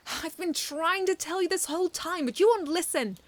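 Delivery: high, loud exasperated complaint; frustrated robot. A narrator's reading. Metaby groaning at the children, high frustrated whine.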